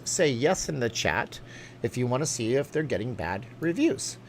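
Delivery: normal talking voice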